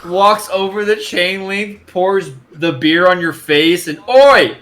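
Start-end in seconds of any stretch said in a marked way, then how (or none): 4.1-4.6 shouting. Acted. NPC yelling after beer.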